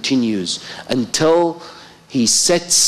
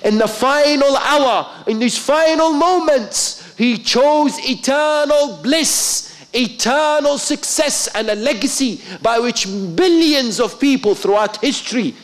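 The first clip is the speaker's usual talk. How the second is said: preaching at full force; 11 semitones higher than usual. Acted. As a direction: ring it out over the congregation. Climactic sermon peak.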